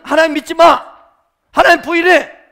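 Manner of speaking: high, very loud order; forceful command. Acted. Preacher shows what the devil does not say, shouted commands. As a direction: shout it as a blunt demand.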